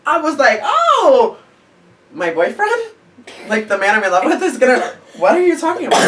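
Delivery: high, loud incredulous squeal; stunned, high-pitched outrage; shocked disbelief. Retold or imitated. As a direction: react like the news is absurd. Shocked at fight news.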